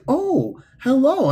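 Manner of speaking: high-pitched, startled polite hello; imitated surprise. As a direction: act out an awkwardly surprised greeting.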